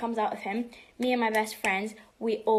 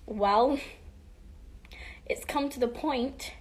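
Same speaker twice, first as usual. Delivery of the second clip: ordinary delivery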